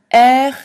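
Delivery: R hacked loud from the throat; loud throaty French R; spat French R. Shown as a demonstration. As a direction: spit the R out hard.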